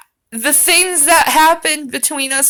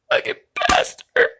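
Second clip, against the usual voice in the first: breathy high whisper, hammed up; mock whisper; 13 semitones higher than usual. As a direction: hiss it under your breath in jest.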